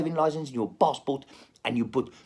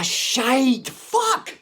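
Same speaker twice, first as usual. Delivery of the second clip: frustrated yell; 12 semitones higher, 6 dB louder than usual. Real, not acted. Sudden frustrated outburst.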